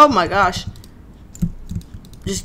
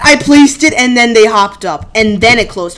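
ordinary delivery

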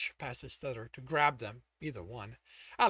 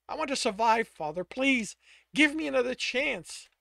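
high, loud desperate plea; begging; 8 semitones higher, 8 dB louder than usual. A narrator's reading. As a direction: beg father for another chance. Son begs father for chance.

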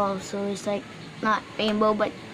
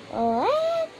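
surprised what. Real, not acted. Shocked toy is from another season.

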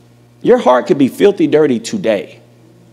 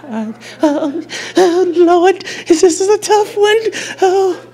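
high, whining put-on voice with a fake sob; mock the congregation as they groan over a hard question; mock whiny churchgoer; 14 semitones higher than usual. Preacher teasing his congregation in a high, whiny mock-crying voice. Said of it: "They're like".

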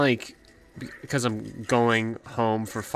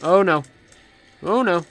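1 alarmed oh no; 6 semitones higher, 7 dB louder than usual. Real, not acted. Danger in game.

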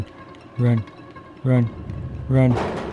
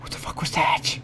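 whisper; 2 semitones higher, 8 dB quieter than usual. Real, not acted. Startled by game enemy.

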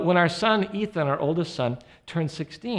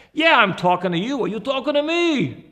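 New York tough guy, rising higher on the comeback; Robert De Niro impression; 5 semitones higher than usual. God answering a movie star in his own voice, De Niro-style New York accent. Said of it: "he answers like this".

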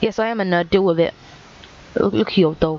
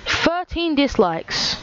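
slow mock dismay; 7 semitones higher, much slower than usual. Mocking his own old video.

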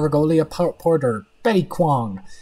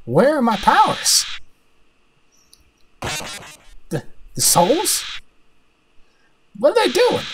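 rising, panicky disbelief; bewildered and alarmed, pitch leaping up; alarmed villain; 6 semitones higher than usual. Acted. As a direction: let the questions climb higher as panic sets in. Villain losing his powers.

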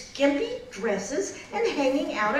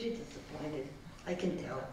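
dejected groundhog; 9 semitones lower, 14 dB quieter than usual. Acted. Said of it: "She said".

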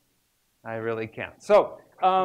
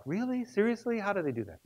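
amazed reaction; 6 semitones higher, 6 dB quieter than usual. Retold or imitated. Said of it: "you go like".

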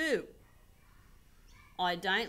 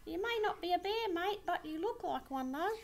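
teasing reply; 7 semitones higher, faster than usual. Acted. Someone teasing the koala, fast and light. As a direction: toss it off quick and teasing.

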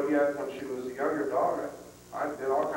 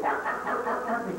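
imitated dog barking; 7 semitones higher than usual. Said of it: "she gets at the end of the lane and goes".

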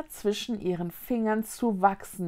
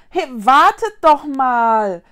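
loud, higher, drawn-out shout; calling after them; 4 semitones higher, 12 dB louder, slower than usual. A narrator's reading. Konrad calls after fleeing ghosts, shouting. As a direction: call out to stop them from afar. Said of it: "rief Konrad ihnen nach".

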